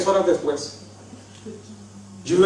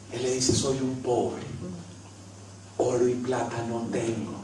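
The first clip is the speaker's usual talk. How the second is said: softer, lower intimate preaching; quiet preaching; 6 semitones lower, 10 dB quieter than usual. Acted. Preacher confessing weakness to congregation, hushed sermon voice. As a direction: draw the congregation close, gently.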